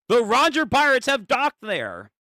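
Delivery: high, loud acted alarm; urgent report. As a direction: sound the alarm to headquarters. Reads urgent report aloud.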